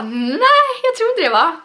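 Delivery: high, loud mock disbelief. Fan hearing the actor is leaving, in high mock disbelief. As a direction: squeal out a stunned no. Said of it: "Man bara".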